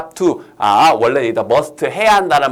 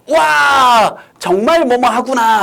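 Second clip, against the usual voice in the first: high, loud burst of amazement; exclamation of wonder; 7 semitones higher, 4 dB louder, slower than usual. Shown as a demonstration. Teacher shows students how to admire something, exclaiming. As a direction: let out a big acted wow. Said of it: "하면서 이렇게 감탄할 때".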